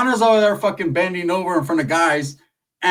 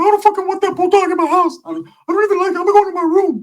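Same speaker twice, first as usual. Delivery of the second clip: shrill high complaint, much higher and louder; whiny high-voiced Ricky; 13 semitones higher, 4 dB louder, faster than usual. Comic voicing his friend's whiny refusal to host the bulldog. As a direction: squeal it like a fussy little guy. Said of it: "And Ricky's like".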